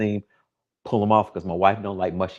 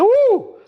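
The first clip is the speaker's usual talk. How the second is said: falsetto woo; 5 dB louder than usual. Retold or imitated. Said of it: "Y'all be like".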